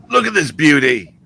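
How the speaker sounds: light imitation of an excited wildlife host; Steve Irwin. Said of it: "the last words from Irwin was".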